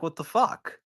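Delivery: high-pitched stunned exasperation; pissed off. Retold or imitated. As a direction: throw it out like a cheated saver in disbelief. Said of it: "And you're pissed off".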